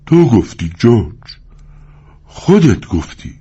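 slow, plaintive protest; a touch of pitiful whining; pitiful whimpering. Acted. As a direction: whine helplessly to George. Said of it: "با لحن رقت انگیزی مینالید".

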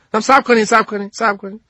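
put-on high pleading kid voice; insist, hands up, wait wait; defending myself. Sneeze defends his chicken to the group, high pleading. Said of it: "از خودم دفاع کردم".

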